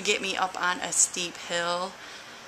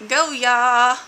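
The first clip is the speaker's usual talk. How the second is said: loud, higher, stretched-out GO YA; drawn-out cheer; 5 semitones higher, 12 dB louder than usual. Shown as a demonstration. Announcing the theme of the week, loud drawn-out cheer. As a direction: belt out "go ya" as a slogan. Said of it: "however you wanna say it".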